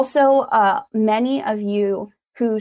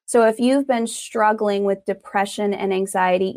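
ordinary delivery

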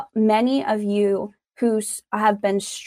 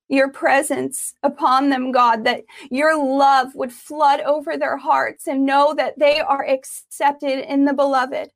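prayer; 4 semitones higher than usual. Acted. Prayer over the congregation.

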